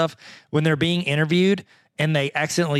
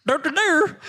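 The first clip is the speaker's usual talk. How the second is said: high mock dur noises; 12 semitones higher, slower than usual. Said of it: "Tyler's like".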